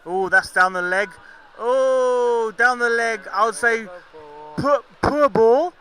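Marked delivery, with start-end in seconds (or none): 1.6-5.7 dismayed commentary. Real, not acted. Ball goes down the leg.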